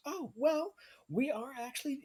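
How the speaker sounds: cheery high voice greeting guests at the door; half-elf maiden. Acted. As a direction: lift way up into a chirpy maiden. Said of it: "And she goes".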